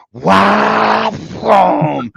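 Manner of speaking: high loud exhilarated whoop; thrilled shout. Retold or imitated. Racing drunk at night, high thrilled shout. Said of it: "I'm just like".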